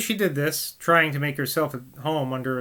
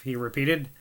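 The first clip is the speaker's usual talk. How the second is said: plain reading voice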